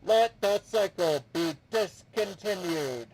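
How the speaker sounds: loud, ringing machine voice; clanging voice. Acted. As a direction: speak like clanging metal. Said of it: "replies in a clanging voice".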